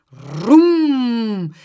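loud, long vroom; drawn-out high, booming engine noise; engine roar imitation. A narrator's reading. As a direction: roar like a motorbike arriving. Imitates a motorbike roaring up.

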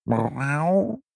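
drawn-out, high meow; cat's meow. A narrator's reading. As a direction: imitate a cat calling. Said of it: "riepen: "Miauw". (langgerekt, hoog gemiauw)

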